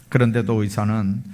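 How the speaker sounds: ordinary talk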